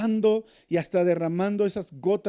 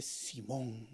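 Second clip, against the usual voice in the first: pointed low rebuke; 5 semitones lower, 13 dB quieter than usual. Retold or imitated. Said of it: "te va a venir y le dice".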